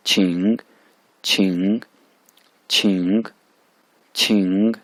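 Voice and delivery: छिंग dipping then rising; third tone demo. Shown as a demonstration. Teacher drilling छिंग in the third tone. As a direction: let छिंग dip and rise. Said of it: "इस पर third tone है".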